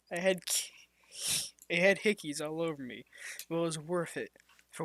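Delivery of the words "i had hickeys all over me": ordinary delivery, nothing marked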